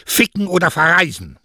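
loud, high, emphatic pronouncement; blunt ultimatum. Acted. Mother gives her daughter two remedies, loudly blunt. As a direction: hurl out the two choices.

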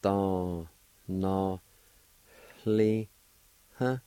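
"Don, no, li, huh" with each syllable held and separated. Shown as a demonstration. Teacher showing the added H sound, syllable by syllable. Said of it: "It's gonna sound like this".

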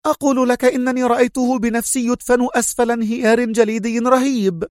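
high, indignant insistence; lieutenant insisting to her chief in anger; angry retort. A narrator's reading. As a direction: cut him off, offended. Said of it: "قاطعته سونيا قائلة في غضب".

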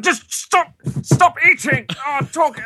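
high-pitched, strained mock yelling; fake dramatic fight. Woman in a fake dinner fight, mock high-pitched shouting. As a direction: squawk it like a hammed-up row. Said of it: "some kind of fake drama".

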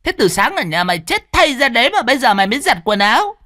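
high, fast shrieking scold; shrill scolding. A narrator's reading. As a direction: screech the accusation at him. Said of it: "Giọng con Tâm cứ rít lên".

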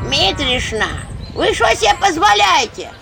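high, loud indignant scolding. A narrator's reading. A woman scolds Mitrishna in the queue, angry. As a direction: tell her off sharply. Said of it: "ругалась с ней какая-то баба".

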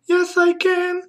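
put-on squeaky-high girl's singsong reply; high-pitched girl. Girl in the pronunciation song, high-pitched voice. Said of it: "with a high-pitched voice".

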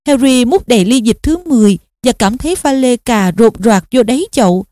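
usual speaking voice

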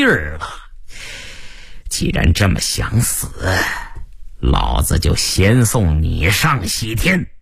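menacing gunman. Acted. Gunman about to shoot Qi Jia, menacing.

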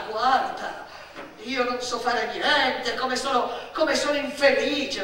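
loud, high sing-song parody of self-pity with laughs; mocking whine. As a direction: jeer at her self-pity by aping it. Adult mocks the child's whining. (presa in giro lamentosa e sarcastica)

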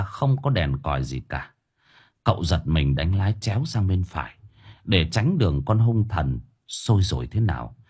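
plain reading voice

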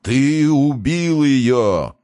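very slow, high, loud monster roar; monstrous voice. A narrator's reading. Monster's voice sweeps over the water accusing Price, roaring. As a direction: roar each word out long and monstrous. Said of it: "пронёсся над водой чудовищный голос".

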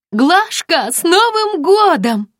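high, loud and slow festive shout; yelling into the phone. Acted. Friend yells a New Year greeting into the phone, loud. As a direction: bellow the greeting down the line. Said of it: "заорала та в трубку".

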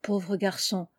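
ordinary delivery

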